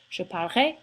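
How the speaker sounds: "Je parlerai" squeezed into two syllables; French future with guttural R. Shown as a demonstration. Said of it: "It's closer to just two syllables".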